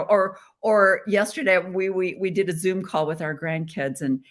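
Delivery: usual speaking voice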